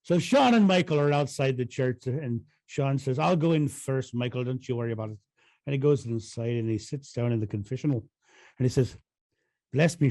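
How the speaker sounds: lightly put-on Irish accent telling a joke; a mild Irish lilt throughout, joke-teller style. Telling a priest joke to a friend, light Irish accent. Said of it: "play with my Irish accent".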